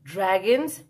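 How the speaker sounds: ordinary delivery, nothing marked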